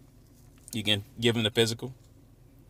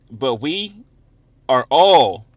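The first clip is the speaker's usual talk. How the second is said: "all" hit much louder and drawn out; stress on "all"; 3 semitones higher, 10 dB louder, much slower than usual. Real, not acted. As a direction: punch the word "all" hard, then stop. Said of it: "I'm gonna emphasize that word all".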